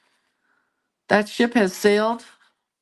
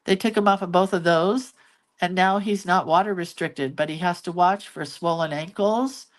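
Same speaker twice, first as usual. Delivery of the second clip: ordinary delivery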